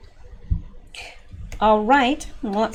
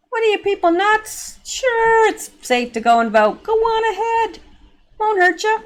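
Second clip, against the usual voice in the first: much higher, mocking sarcasm; sarcastic disbelief; 11 semitones higher than usual. Real, not acted. Vlogger scoffing at the advice to vote in person, high sarcasm. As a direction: throw it back at them, dripping sarcasm.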